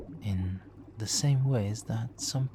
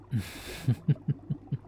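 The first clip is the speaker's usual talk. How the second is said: sly villain chuckle; 3 semitones higher than usual. Acted. Villain reveals an interesting use for the power, sly chuckle. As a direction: chuckle at your own secret plan.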